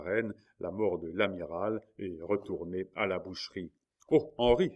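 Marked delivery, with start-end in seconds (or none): 4.1-4.7 high, loud desperate call to Henri; cry out. A narrator's reading. Marguerite calls out to the duke, loud and desperate. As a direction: cry his name across the corridor. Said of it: "s'écria Marguerite".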